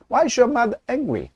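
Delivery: slow falling question for repeating; falling end. Shown as a demonstration. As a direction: model line, "Why" high, "angry?" falls. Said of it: "따라해 보실까요". (따라 하도록 끝을 내리는 억양)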